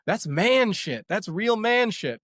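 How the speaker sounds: high, slow mock holler; drawn-out, high-pitched retold yell; imitated yelling. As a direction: imitate an excited driver hollering, words stretched out. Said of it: "she was, like, yelling and screaming".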